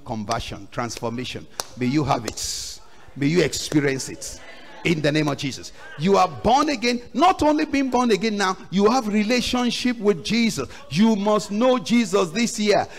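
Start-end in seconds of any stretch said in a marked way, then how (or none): 6.0-12.9 high, insistent sermon delivery; forceful preaching. Real, not acted. Preacher urges congregation to know Jesus, forceful preaching. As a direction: drive each question home to the congregation.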